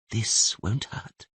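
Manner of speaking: calm a dying man gently; gentle soothing voice. Acted. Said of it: "said the gentle voice".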